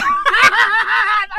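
high, shouted burst of laughter; real high cackle. Real, not acted. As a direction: crack up at your own outrageous line. Cracks up at own line.

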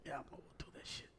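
quick breathy whisper; whispered mockery of a half-hearted vow. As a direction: toss it off in a hushed, fast whisper. Mocking a lazy person's resolve.

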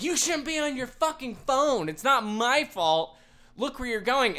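high, loud mock yell at a pedestrian; imitating a yelling driver. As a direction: ham up the angry driver scolding. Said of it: "she yells at me".

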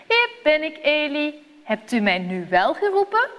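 lightly voiced eager boy; a hint of a willing young boy answering; boy Samuel. A narrator's reading. Samuel runs to wake old Eli, lightly voiced eager boy. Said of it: "Samuel rent opnieuw naar Eli".

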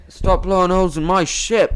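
a weak stab at Jack's voice; half-hearted pirate captain voice; bad Jack Sparrow. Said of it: "Oh, that's terrible".

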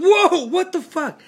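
jolted awake and yelping in shock; freaked-out yelp. Retold or imitated. Son waking to mom in the dark corner, startled high yelp. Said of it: "I just freak out".

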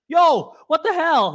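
startled reaction. Retold or imitated. Retelling his shock at the near miss, loud and slow. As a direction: blurt it out as the car nearly hits. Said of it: "I was like".

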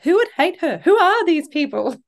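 high, incredulous read of a fan's reply; mock indignation. Host reading a fan's reply to Lucy, mock incredulous. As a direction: play up the fan's protective outrage. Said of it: "someone did reply to that".

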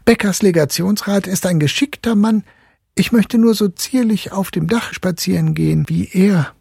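put-on high boy's voice; young boy admiring the cat. A narrator's reading. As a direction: praise the cat eagerly. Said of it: "erwiderte Fritz".

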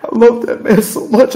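half-whispered then breaking into a laughing sob; reliving crying over the preacher; retold grief, whisper to a sob. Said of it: "I was just, I was".

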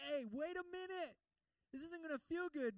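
high-pitched, urgent warning; urgent interjection. Acted. Holy Spirit stopping us on the path, urgent warning. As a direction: call out to stop someone.

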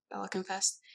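plain reading voice

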